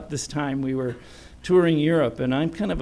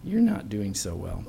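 quiet quote; 7 semitones lower, 8 dB quieter than usual. Retold or imitated. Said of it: "the hostess that was hosting us says".

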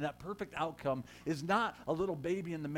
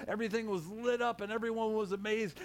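normal talking voice

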